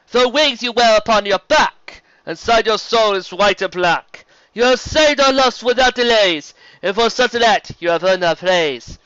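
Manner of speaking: high, loud, ceremonial chant of a zebra elder; rhyming proclamation. Acted. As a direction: proclaim it to the whole herd in verse.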